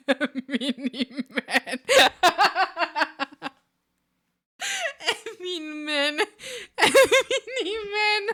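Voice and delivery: helpless high laughing. Real, not acted. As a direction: crack up reading it. Reading misspellings, cracking up.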